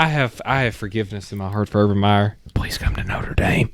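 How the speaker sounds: hushed, low whisper; whispered mock plea. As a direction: whisper it like a secret prayer, playfully. Mock forgiveness and plea to coach.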